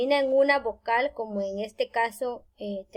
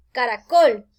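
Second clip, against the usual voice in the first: "caracol" louder, weight falling on COL; stress on last syllable; 7 dB louder than usual. Shown as a demonstration. Teacher showing where the force falls, stress on last syllable. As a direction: hit the final COL of "caracol" firmly. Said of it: "cae en la última sílaba".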